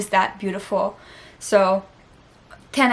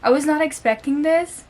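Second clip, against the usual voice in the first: higher and louder, voice straining with sudden emotion; an overwhelmed, half-choked admission; getting emotional; 6 semitones higher, 5 dB louder than usual. Real, not acted. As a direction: caught off guard by tears welling up. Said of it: "I don't know why I'm starting to get emotional".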